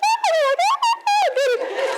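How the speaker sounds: very high, squealing oh oh; delighted squeal. Retold or imitated. Finding a pink sweatsuit for her sister, squealing with delight. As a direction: squeal with glee over a gift.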